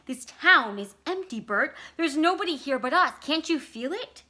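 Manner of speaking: urgent and spooked; wife insisting the town is empty, scared and loud; scared insistence. Acted. Vicky frightened by empty town.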